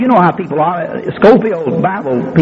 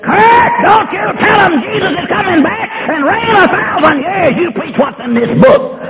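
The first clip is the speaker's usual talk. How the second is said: higher, shouted warning, slowing at the end; stern warning; 12 semitones higher than usual. Retold or imitated. Elder shaking a Bible at the young preacher, shouted warning. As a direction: bark the order at the young preacher.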